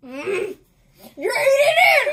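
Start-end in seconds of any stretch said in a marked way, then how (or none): 1.2-2.1 high, loud through laughter; laughing. Real, not acted. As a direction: shout it while laughing. Laughing accusation during game.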